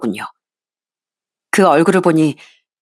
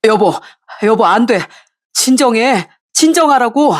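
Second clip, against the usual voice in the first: frantic restraining; 4 semitones higher than usual. Acted. Husband restrains wife.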